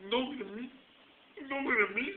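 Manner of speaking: a hint of shy squirming; mock pleading. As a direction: beg them not to look, half joking. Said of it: "that's the way I felt".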